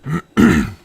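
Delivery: loud throat clear after water went down the wrong pipe; rough loud throat clearing. Real, not acted. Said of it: "Sorry if I'm clearing my throat a lot".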